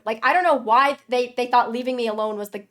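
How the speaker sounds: ordinary talk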